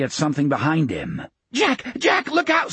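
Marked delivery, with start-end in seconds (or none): 1.5-2.7 very high, frantic warning; panicked warning. Acted. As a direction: shout the warning in terror. Warning shout.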